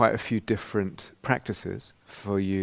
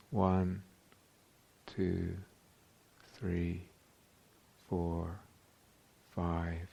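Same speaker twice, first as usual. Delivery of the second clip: slow, softly spaced count, lower after the first number; counting within breath; 3 semitones lower, 6 dB quieter, much slower than usual. Shown as a demonstration. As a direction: count slowly across one long breath, a pause after each number. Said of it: "for the duration of that long breath".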